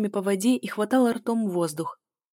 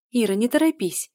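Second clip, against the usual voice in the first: put-on higher, louder call after a child; raised-voice motherly call; 5 semitones higher, 5 dB louder than usual. A narrator's reading. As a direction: call after your daughter as she runs ahead. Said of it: "звала я".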